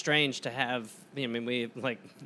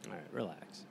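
much softer, low; calming; 8 semitones lower, 14 dB quieter than usual. Real, not acted. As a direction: settle him down gently. Calming partner's rant.

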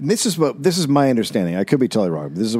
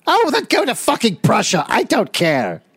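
high, put-on scolding; shrill up into a fed-up mother; exasperated mock mother; 10 semitones higher than usual. Mother dismissing her son to Prussia, high mock exasperation.

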